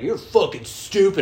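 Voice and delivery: mock the kid with contempt; scornful kids. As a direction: classmates jeering at him. Kids insulting him.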